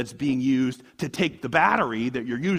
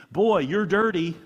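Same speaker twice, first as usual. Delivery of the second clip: put-on telling off the kid; 4 semitones higher, 6 dB louder than usual. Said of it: "when I say to my kid".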